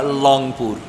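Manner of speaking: লংপুর with an L in place of the R of Rangpur; Rangpur mispronunciation. Retold or imitated. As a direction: imitate locals saying লংপুর for Rangpur, drawn out. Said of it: "র উচ্চারণ করতে পারে না".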